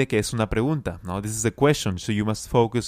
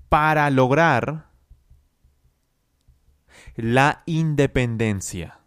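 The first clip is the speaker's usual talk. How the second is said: slow chunks, higher and a bit louder; teacher drilling a sentence in chunks; slow repeat-after-me; 4 semitones higher, 3 dB louder, slower than usual. Shown as a demonstration. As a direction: lift each chunk up and slow it for the class to copy. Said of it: "Practique conmigo".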